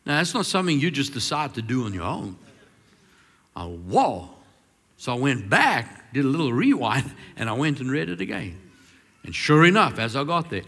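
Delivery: usual speaking voice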